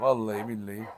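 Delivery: normal talking voice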